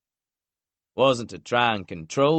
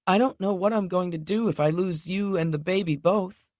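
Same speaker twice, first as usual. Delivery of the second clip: higher voice of a frightened woman; voiced young woman, fearful; 10 semitones higher than usual. A narrator's reading. Lexi telling Knox her fear of losing him, voiced higher. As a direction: let her fear of loss show in a higher voice.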